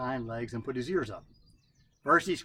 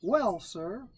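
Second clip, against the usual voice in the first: Brer Rabbit voice; 7 semitones higher than usual. A narrator's reading. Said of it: "said Brother Rabbit".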